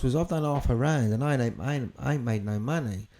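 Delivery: normal talking voice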